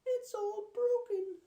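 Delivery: very high, loud tearful cub voice; Baby Bear upset. Acted. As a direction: cry out like a cub over a broken toy. Baby Bear finds broken chair.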